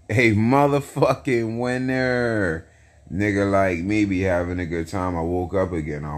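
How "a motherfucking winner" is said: big hyped boast, drawn out. Real, not acted. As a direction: crow it like a champion. Hyped self-praise.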